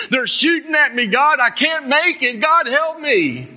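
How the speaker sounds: terrified plea building to a shout; desperate prayer. Retold or imitated. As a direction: pray like you're pinned down under fire. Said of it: "now I'm gonna talk back to me".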